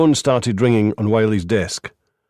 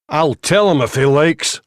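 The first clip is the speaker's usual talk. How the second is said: higher, louder, mouth full of biscuit; talking through biscuit; 6 semitones higher, 4 dB louder than usual. A narrator's reading. Reynolds with his mouth full, loud and defiant. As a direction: spit it out mid-chew. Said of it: "bits of biscuit flying from his mouth".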